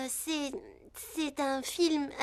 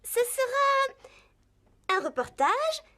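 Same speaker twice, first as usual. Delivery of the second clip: dramatic reveal; 7 semitones higher, much slower than usual. Acted. Dramatic announcement of next scoop.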